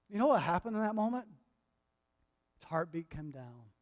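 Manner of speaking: normal talking voice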